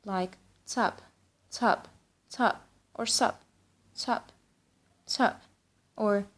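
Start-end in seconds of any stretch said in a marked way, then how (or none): 0.6-2.6 "Sup" as one short syllable; quick, casual one-beat "Sup"; clipped-down greeting. Shown as a demonstration. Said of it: "say it in a lot of ways". 3.0-5.4 "Sup" as one short syllable; soft, casual one-beat "Sup"; clipped-down greeting. Shown as a demonstration. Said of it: "say it in a lot of ways".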